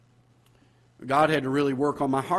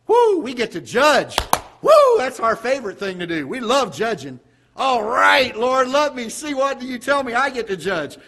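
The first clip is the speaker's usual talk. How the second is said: higher and louder, hammed-up gleeful whoops; mock glee; 9 semitones higher, 8 dB louder than usual. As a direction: play the eager judge, all fake delight. Mocking Baptists' eagerness to judge.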